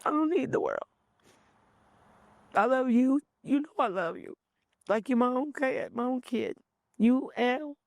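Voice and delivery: fighting back tears as she speaks; real, tearful love for her nephew; choked up. Real, not acted. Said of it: "that was emotional for me".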